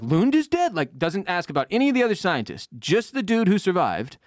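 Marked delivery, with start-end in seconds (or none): 0.0-0.7 high shocked squeak; shocked disbelief. Retold or imitated. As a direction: gasp it out in disbelief. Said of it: "He's like".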